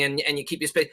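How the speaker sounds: ordinary talk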